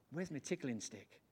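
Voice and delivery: ordinary talk, nothing marked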